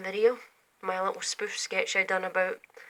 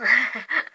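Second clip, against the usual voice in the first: laughing at himself; 4 semitones higher, 10 dB louder than usual. Real, not acted. Laughs at own voice.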